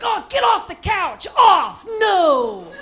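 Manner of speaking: loud, drawn-out dog-owner commands; exasperated pet-training shout; scolding a dog. Acted. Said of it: "treating them like a wet dog".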